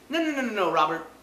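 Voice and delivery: usual speaking voice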